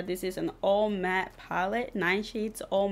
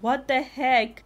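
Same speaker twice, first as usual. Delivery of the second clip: surprised; 4 semitones higher, 6 dB louder, slower than usual. Real, not acted. Surprised by green shadow performance.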